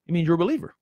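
ordinary talk

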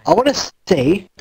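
ordinary talk

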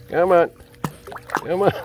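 high, loud encouraging call; urging the dog on, pitched high and loud; high coaxing call. Real, not acted. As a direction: coax him over, bright and up high.

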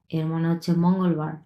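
ordinary talk, nothing marked

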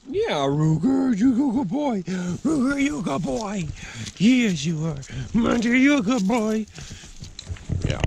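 high, cooing voice for the dogs, slow at the start. Real, not acted. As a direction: gush over the dogs like they're babies. Owner cooing at his dogs.

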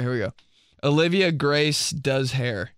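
normal talking voice

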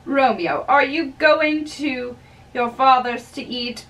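ordinary delivery

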